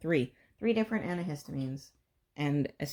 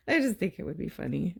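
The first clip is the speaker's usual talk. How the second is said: usual speaking voice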